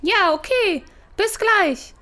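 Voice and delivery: posing as Susi. Acted. Thief pretends to be Susi.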